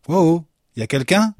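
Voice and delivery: high, loud call. A narrator's reading. Visitor hailing an unseen office clerk, calling out. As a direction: call out to see if anyone is there. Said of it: "cria-t-il".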